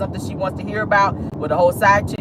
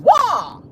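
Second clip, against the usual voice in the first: high, loud karate battle cry; Bruce Lee kiai; 12 semitones higher, 4 dB louder than usual. Retold or imitated. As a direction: let out a kung fu yell, pitched way up. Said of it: "that's what Bruce Lee used to say".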